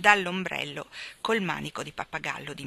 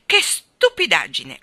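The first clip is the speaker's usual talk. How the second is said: scolding squawk, much louder; loud clucking voice; 10 dB louder, slower than usual. A narrator's reading. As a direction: cluck it out loudly, dragging the words. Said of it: "disse forte una voce chioccia". (voce chioccia e forte)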